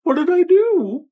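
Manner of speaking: put-on very high, squeaky pleading kid voice; a small kid protesting innocence; whiny kid.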